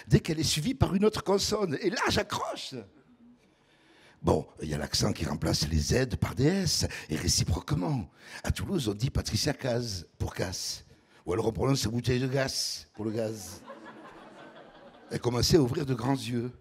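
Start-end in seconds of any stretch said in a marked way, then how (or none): 4.2-13.6 deep velvety southern voice. Pretend. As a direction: put on a deep velvet voice, like a charmer. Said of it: "Voix grave, veloutée". (voix grave et veloutée, ton chaleureux)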